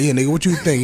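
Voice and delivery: defiant shout. Retold or imitated. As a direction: snap back defiantly. Defiant retort retold.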